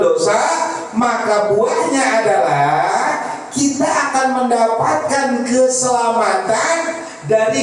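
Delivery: slow, sustained high sermon chant; preaching. Acted. Preacher explaining the fruits of weeping to the congregation, preaching. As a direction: hold the pitch and draw out the endings.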